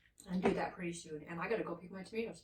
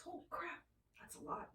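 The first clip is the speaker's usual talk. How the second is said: hushed "Holy crap" under the breath; whispered; 12 dB quieter than usual. Real, not acted. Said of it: "said again whispered".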